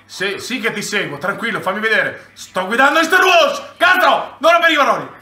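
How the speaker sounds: normal talking voice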